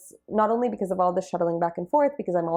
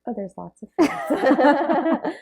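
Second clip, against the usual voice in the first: high, slow, laughing it off; rueful laugh, slow and high; 11 semitones higher, 4 dB louder, slower than usual. Real, not acted. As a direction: laugh through the admission of fears. Laughing at own fears.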